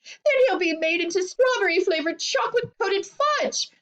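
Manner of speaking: high, horrified screech; screamed. A narrator's reading. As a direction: screech it in outrage. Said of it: "screamed Missus Globe".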